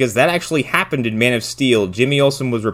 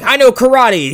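karate guy; 13 semitones higher, 9 dB louder than usual. Retold or imitated. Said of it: "he's like".

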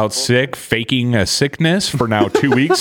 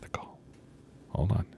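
soft whispered aside; whispering; 3 semitones lower, 18 dB quieter than usual. Real, not acted. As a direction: whisper so the caller can't hear. Quiet aside while the call connects.

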